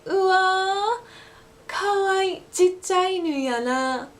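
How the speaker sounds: high, slow Osaka Ben coo over a puppy; Osaka Ben gushing. Shown as a demonstration. Teacher showing the Osaka Ben version, cooing in Osaka Ben. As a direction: gush in Osaka Ben with chichai. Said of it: "that same sentence would be".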